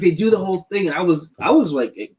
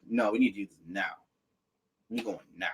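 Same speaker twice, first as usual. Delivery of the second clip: low determined Finn; 6 semitones lower, 11 dB quieter, faster than usual. Retold or imitated. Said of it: "Finn's like".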